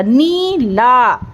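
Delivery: "नीला" very slowly, both vowels held long; "नीला" in two drawn-out syllables; stretched vowels. Shown as a demonstration. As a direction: pull out "नी", then a long "ला". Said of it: "ना को खींच के बोला".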